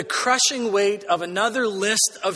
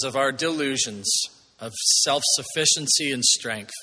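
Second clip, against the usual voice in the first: humble, prayerful pastor's voice, a bit lower; opening prayer; 4 semitones lower than usual. Acted. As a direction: pray it reverently before the sermon. Opening prayer before sermon.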